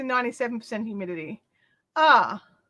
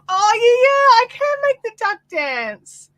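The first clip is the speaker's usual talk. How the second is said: high, loud, slow delighted squeal; gleeful about making the duck dance; excited squeal; 10 semitones higher, 8 dB louder, slower than usual. Real, not acted. Vlogger answering chat about the dancing duck, squealing with delight.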